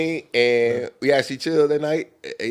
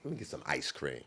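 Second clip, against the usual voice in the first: quiet aside; 10 semitones lower, 19 dB quieter than usual. Retold or imitated. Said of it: "I was just like".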